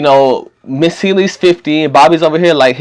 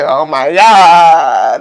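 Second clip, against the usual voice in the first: mock exasperation; much slower than usual. Retelling reaction to others laughing.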